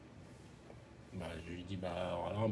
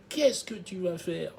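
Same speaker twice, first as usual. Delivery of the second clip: higher, slow taunting dare; menacing challenge; 6 semitones higher, slower than usual. Retold or imitated. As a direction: square up and dare him, slowly. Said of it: "juste devant mon visage et il me fait".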